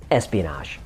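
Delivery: ordinary delivery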